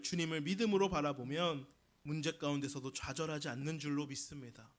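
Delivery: usual speaking voice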